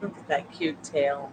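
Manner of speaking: normal talking voice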